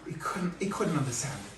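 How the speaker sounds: ordinary delivery, nothing marked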